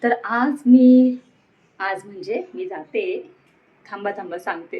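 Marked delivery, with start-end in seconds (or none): 0.0-1.1 slow, louder vlogger welcome; vlog greeting. Acted. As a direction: greet your viewers brightly and slowly. (व्लॉगरचे स्वागत)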